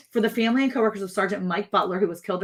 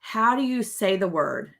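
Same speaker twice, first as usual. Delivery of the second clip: usual speaking voice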